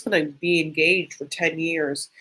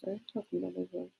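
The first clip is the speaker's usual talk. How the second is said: quick, much softer protest under her breath; defensive mumble; 15 dB quieter, faster than usual. Real, not acted. Mutters back quickly to the friend who scolded her.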